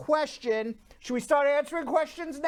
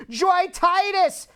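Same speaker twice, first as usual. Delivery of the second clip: ordinary talk, nothing marked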